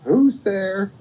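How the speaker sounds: high-pitched storybook grandmother; grandmother's call. Acted. Grandmother asking who knocked, high-pitched call. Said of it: "And grandmother says".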